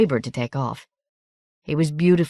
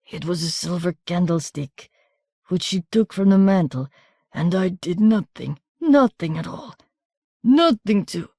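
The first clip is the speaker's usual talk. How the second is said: pained, wandering, bitter wounded voice; a hurt man raving in confusion; delirious wounded man. A narrator's reading.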